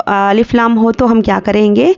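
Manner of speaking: normal talking voice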